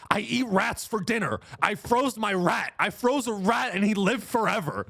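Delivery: high, insistent raving; hammed-up deranged rambler; subway crazy guy. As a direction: rave like a madman on the subway. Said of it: "This is a guy who's like".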